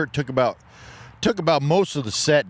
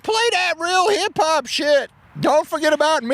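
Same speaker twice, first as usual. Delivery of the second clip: bar heckler voice; 14 semitones higher than usual. Acted. Fake bar patron shouting a song request, squeaky and high. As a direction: yell a request from the crowd in a squeaky voice.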